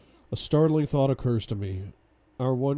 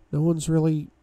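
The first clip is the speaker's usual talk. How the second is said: high, hesitant voice for her, trailing away; 7 semitones higher than usual. A narrator's reading. Shy woman answering why she has no experience, trailing off. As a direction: leave it hanging, higher and unfinished. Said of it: "she trails off".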